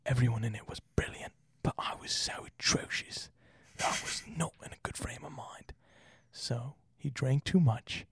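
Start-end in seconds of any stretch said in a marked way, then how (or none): 0.1-5.7 hushed whisper of a regretful actor; whispered quote. Retold or imitated. Whispering the actor's regret to listeners. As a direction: breathe the confession out.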